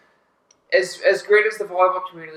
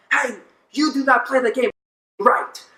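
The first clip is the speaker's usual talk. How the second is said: imitating an indignant opponent, raised pitch; mock scolding in a higher voice; retold angry yelling; 7 semitones higher than usual. Player scolds Dallas after the skyball, mock-angry. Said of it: "he starts yelling at me".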